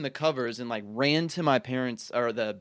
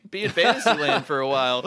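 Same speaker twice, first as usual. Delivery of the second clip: laughing; 8 semitones higher, 6 dB louder than usual. Retold or imitated. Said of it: "and I was like".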